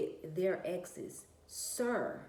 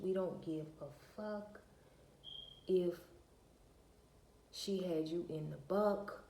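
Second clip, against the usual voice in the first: usual speaking voice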